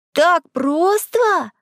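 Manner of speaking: high, drawn-out incredulous question; amazed kid, voice way up; clamouring amazement. A narrator's reading. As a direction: stretch it out in astonishment. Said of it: "загалдели приятели".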